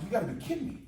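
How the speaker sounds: mock disbelief. Retells disbelief at buddy's news.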